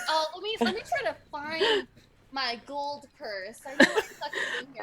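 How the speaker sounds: a coy damsel digging for her purse; flirty fumbling. Acted. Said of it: "loosen up my shirt and be like".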